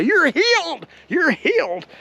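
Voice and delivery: high, ringing mock healing proclamation; imitated faith healer. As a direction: imitate a televangelist declaring a miracle. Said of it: "if you ever watched Oral Roberts".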